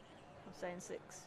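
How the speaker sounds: whispered, slow repeat; hushed, drawn-out aside; under breath. Real, not acted. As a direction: mutter the number to yourself.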